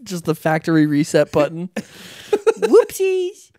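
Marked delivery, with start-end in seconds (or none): 2.6-3.5 very high, cutesy mock oops; mock-innocent falsetto; playful oops. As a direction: squeak it in a silly little voice. Playful mock reaction to reset button.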